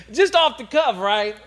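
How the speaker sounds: loud high burst of laughing; real laughter. Real, not acted. Laughing at the DJ's dismissal.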